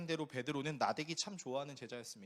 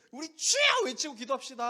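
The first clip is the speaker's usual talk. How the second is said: loud, high rally call; revival leader; 9 semitones higher, 10 dB louder than usual. Retold or imitated. Worship leader rousing youth at a rally, loud hype. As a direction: whip up the crowd. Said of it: "이러면".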